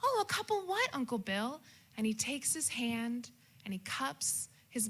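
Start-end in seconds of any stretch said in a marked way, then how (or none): 0.0-1.6 put-on innocent child. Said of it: "child asks uncle".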